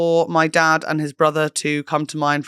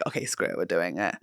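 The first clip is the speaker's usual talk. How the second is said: unvoiced resigned aside; 12 semitones lower, 11 dB quieter than usual. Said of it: "we're just like".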